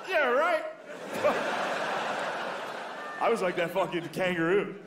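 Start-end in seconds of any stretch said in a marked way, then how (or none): 0.1-0.6 very high, louder weak retort; squeaky feeble comeback. Retold or imitated. Comedian stunned by the insult, squeaky high retort. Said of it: "I was just like".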